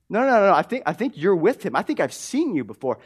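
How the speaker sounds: high, loud insistence, settling as it goes; pressing accuser. Retold or imitated. Servant girl pressing Peter about Jesus, imitated insistence. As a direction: imitate a servant girl who won't let it go. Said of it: "she keeps pressing the issue".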